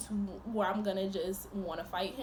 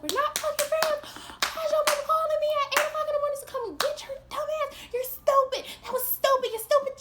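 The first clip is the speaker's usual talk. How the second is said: much higher, louder, fast yelling; mock yell, full volume; retold yelling; 9 dB louder than usual. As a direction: scream it at him. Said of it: "I was like".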